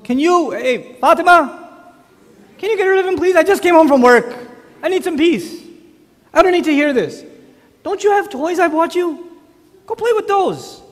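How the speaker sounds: high, loud mock outburst; exasperated father. Father home from work wants his child removed, loudly exasperated. Tired father snaps, calls for wife.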